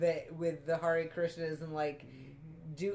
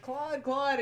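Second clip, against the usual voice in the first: calling out; 7 semitones higher than usual. Retold or imitated. Hippies calling for Claude.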